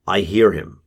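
usual speaking voice

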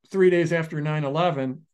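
normal talking voice

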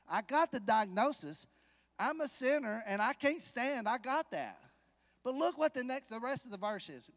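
loud, high, driving sermon voice; fervent preaching. Acted. Preacher driving home the point to the church. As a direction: press the point home hard.

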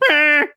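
high, loud squeaky "Meh"; comic high yelp. Retold or imitated. Retelling a movie scene where Colonel Sanders is hit, squeaky yelp. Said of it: "he goes".

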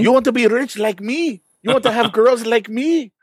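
high-pitched put-on Vietnamese accent; Tom Vu. Co-host parodies a late-night seminar pitchman, hammed-up Vietnamese accent. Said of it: "I could so see you doing Tom Vu".

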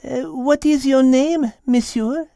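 put-on high, slow French-accented cat lady; Mademoiselle. Cat questioning a sorry stranger, calm high French-accented voice. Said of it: "as she calmly sat and studied him".

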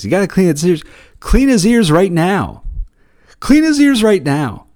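imitating a pushy old lady ordering; mock-aggressive repeated order; aggressive demand. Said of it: "super aggressive about cleaning".

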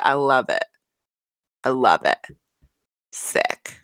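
hint of a badly imitated mom, dropping low; Lisa. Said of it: "it's different every time".

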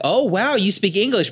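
high, amazed mock gasp; mock surprise. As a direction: overdo the amazement. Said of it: "they'd be like".

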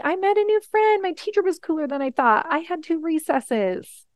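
put-on lightly high, eager voice of a boy listing good news; happy kid voice. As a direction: rattle it off like an excited kid. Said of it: "And he told me".

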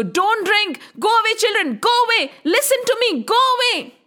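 high, insistent warning; urgent warning. A narrator's reading. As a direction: send the children away urgently, voice pitched high. Old man orders children away.

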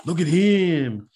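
wobbly, uncertain attempt at a French accent; botched French accent. Imagined foreigner admiring an American, botched French accent. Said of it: "was supposed to be a French accent".

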